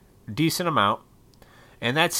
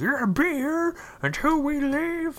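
high-pitched put-on parental order; mock parent voice. Parent telling a kid to stay, mocking high voice. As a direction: imitate a scolding parent in a much higher voice.

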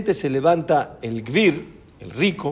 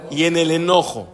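ordinary talk, nothing marked

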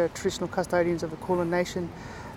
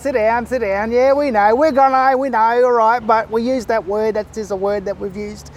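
loud dismissive; 3 semitones higher, 7 dB louder than usual. Retold or imitated. Elder silences the objector loudly.

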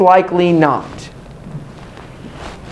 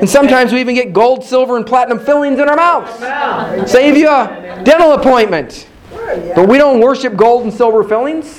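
higher, fast and driving; fired-up preaching; 10 semitones higher than usual. Acted. Preacher rousing the congregation about miracles. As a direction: preach it out with rising fire.

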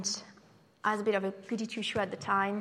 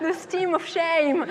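slow laughing punchline; 6 semitones higher, 9 dB louder, slower than usual. Real, not acted. Punchline delivered slowly.

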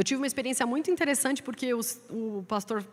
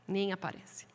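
nem aparece dropped much softer and lower; quiet take; 3 semitones lower, 8 dB quieter than usual. Shown as a demonstration. Preacher repeating that the Bible barely shows up, soft and quiet. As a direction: let "Nem aparece" fall away, softly and low.

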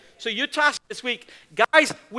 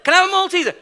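pestering whiny nagging; 9 semitones higher, 7 dB louder, much faster than usual. Retold or imitated. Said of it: "She must have asked me five times".

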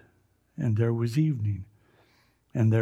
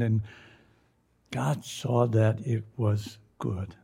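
usual speaking voice

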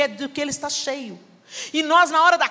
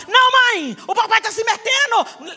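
higher, louder indignant daughter; angry retort; 9 semitones higher, 4 dB louder than usual. Retold or imitated. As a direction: snap back at mom. Daughter yells at mother.

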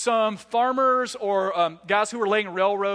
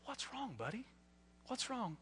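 much softer, tender asking; gentle dad; 3 semitones higher, 21 dB quieter than usual. Retold or imitated. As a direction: soothe a little boy about to cry. Dad comforting tearful son.

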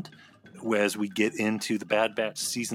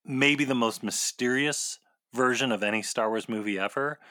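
usual speaking voice

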